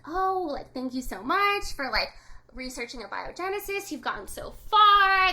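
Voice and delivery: mock-effusive thanks; acted gushing praise. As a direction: imitate impressed backers thanking someone. Investors gushing praise at the boss.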